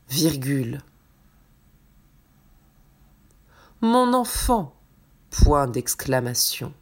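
slow, clear dictation voice; dictating. Acted. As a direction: read it out slowly word by word. Dictation read to the class.

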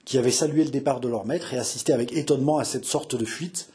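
ordinary delivery

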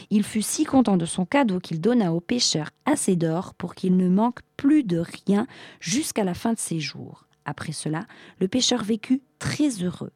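usual speaking voice

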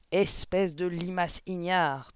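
slow, loud, furious insult; thundering. A narrator's reading. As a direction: thunder it out word by word. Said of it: "tonna mademoiselle Le Gourdin". (fureur tonnante)

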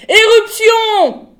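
high, drawn-out full-voiced shout; long, loud, high-pitched alarm cry; screamed alarm. A narrator's reading. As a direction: scream a warning out in every direction. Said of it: "hurla-t-elle ensuite dans toutes les directions".

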